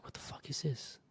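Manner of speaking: stunned whisper. Retold or imitated. Reacting to the hate email, stunned whispered disbelief. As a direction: breathe out the shock. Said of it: "and I was like".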